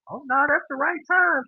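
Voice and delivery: high-pitched reenacted dismay; dismayed realization. Retells realizing he slept very late.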